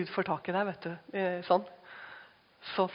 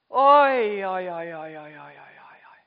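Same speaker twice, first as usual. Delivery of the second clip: loud then fading to a whisper; overwhelmed preacher, fading to a breath; awed oi fading; 4 semitones lower, 6 dB louder than usual. Real, not acted. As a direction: let the wonder die away.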